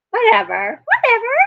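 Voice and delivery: high dismissive whatever. Real, not acted. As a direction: wave it away with a laugh. Brushing off own awkward joke.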